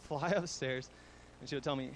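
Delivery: usual speaking voice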